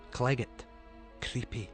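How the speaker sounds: plain reading voice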